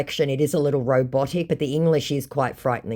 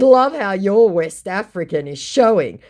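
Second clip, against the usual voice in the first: taunting; 6 semitones higher, 5 dB louder than usual. Retold or imitated. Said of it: "I said".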